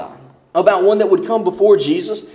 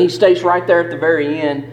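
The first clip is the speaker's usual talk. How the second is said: ordinary talk, nothing marked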